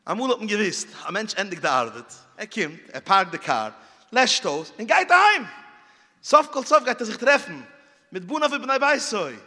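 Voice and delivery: loud, high storyteller's opening; storytelling. Acted. Storyteller launching his tale to the crowd, loud and high.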